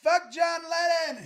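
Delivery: loud, high, slow mock holler; retold yelling. Walking backwards away from his rival on the boardwalk, imitated drawn-out yell. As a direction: imitate bellowing it across a widening gap. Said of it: "we're still yelling at each other".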